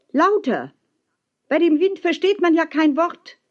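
high-pitched call against the wind; zurückgerufen. A narrator's reading. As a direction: call up to him over the wind. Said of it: "rief ich zurück". (mit hoher Stimme gegen den Wind rufen)

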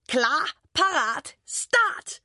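slow count-off rising to a high shout; race-start shout. A narrator's reading. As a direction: draw it out, then launch the final word. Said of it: "råber Boris". (langtrukkent startråb)

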